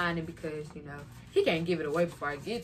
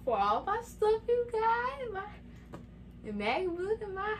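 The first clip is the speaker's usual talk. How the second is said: excited thanks; 13 semitones higher, slower than usual. Real, not acted. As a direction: gush thanks to the parents. Thanking parents on camera.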